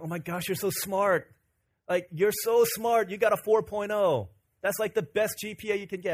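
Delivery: a classmate marveling at a four point oh; impressed. Retold or imitated. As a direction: gush over the straight A's. Albert praising his grades.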